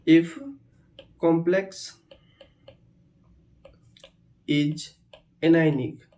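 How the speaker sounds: very slow, long pauses between words; word-by-word dictation. Shown as a demonstration. Teacher dictating a rule to students, word by word. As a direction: dictate it one word at a time.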